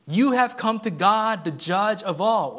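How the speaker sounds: stirring pulpit proclamation; preaching at full force. Acted. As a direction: proclaim it with rising fervour.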